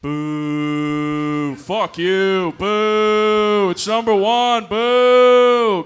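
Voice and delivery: long hostile jeers at full volume; booing crowd. Retold or imitated. Stadium crowd turning on a little girl, loud rising jeers. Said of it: "a stadium full of people turned".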